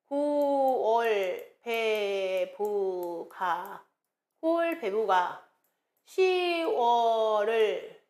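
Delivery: very slow, held syllables; drawn-out classroom recitation; slow mnemonic drill. Shown as a demonstration. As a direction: stretch every syllable like a memorization chant.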